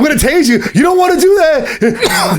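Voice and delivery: half-laughing cop threat; laughing mock warning.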